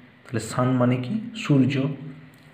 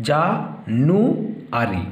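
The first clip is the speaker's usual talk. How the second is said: "জা, নু, আরি" in three parts with long pauses; syllable by syllable; slower than usual. Shown as a demonstration. Teacher dividing January for pupils, "জা, নু, আরি" syllable by syllable. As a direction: leave a clear gap after each piece of "জা, নু, আরি". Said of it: "তিনটে সিলেবলে ভাগ করব".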